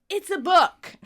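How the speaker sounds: loud, high acted exclamation; high-pitched mock-triumphant burst; mock discovery. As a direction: announce it like a big discovery, loudly. Acted announcement after shaking the package.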